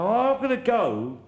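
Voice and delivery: hammed-up high whine, slowed down; mock squeak. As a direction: squeal it out like a fleeing child. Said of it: "I go".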